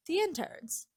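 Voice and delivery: a lightly creepy high voice for the boss; creepy reveal. Retold or imitated. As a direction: say it high and sinister. Tree reveals where blood came from.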